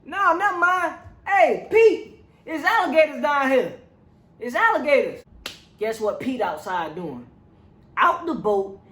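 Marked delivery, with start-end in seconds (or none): 0.0-5.2 imitating Wayne. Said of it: "Wayne like".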